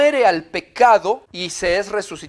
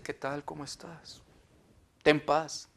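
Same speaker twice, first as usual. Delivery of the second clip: hushed, far softer, calm and serene; serene monk voice; 3 semitones lower, 11 dB quieter than usual. Retold or imitated. The changed friend greeting you as a calm monk, hushed. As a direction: murmur it like a peaceful monk. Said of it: "Y ahora te dice".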